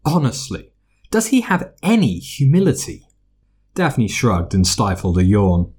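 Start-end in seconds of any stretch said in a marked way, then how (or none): 0.0-3.1 huffy. A narrator's reading. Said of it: "Hermione huffed".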